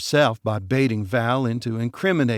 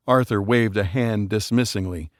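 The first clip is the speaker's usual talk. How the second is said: ordinary delivery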